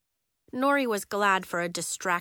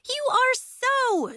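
much higher, louder and slow; loud accusing; 6 dB louder, much slower than usual. Acted. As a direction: drag out the accusation. Nori insisting loudly.

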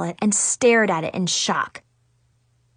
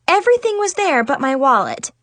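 usual speaking voice

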